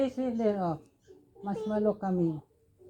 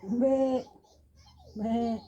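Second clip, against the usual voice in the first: raised, drawn-out bleats; goat bleating; 5 semitones higher, 5 dB louder than usual. Shown as a demonstration. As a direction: imitate a goat for a child. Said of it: "The goat".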